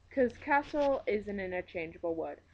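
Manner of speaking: usual speaking voice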